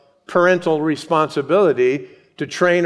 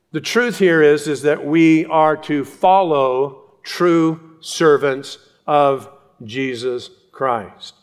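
usual speaking voice

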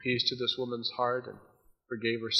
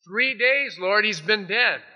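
high, slow exasperated protest; 8 semitones higher, 6 dB louder, slower than usual. Acted. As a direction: throw up your hands in disbelief. Martha's exasperated objection voiced.

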